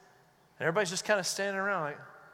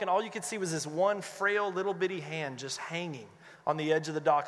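normal talking voice

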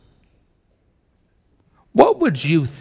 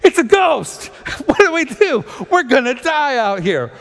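panicked disciples; 11 semitones higher than usual. Retold or imitated. Disciples seeing a ghost on the sea, mock high panic. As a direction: ham up the terror of the disciples. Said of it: "They go".

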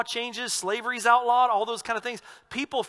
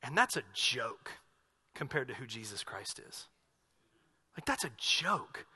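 1 hushed awe; 8 semitones lower, 10 dB quieter than usual. Real, not acted. Awed hushed aside about Jesus.

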